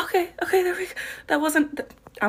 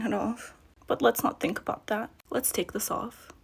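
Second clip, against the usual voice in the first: whispering; 10 dB quieter than usual. Real, not acted. Whispering, everyone asleep.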